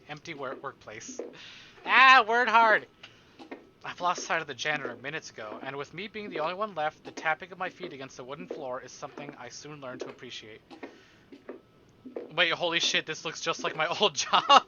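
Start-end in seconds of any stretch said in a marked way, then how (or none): 1.9-2.9 loud. Real, not acted. Slow loud joking exclamation. 12.3-14.6 loud, excited recognition. Real, not acted.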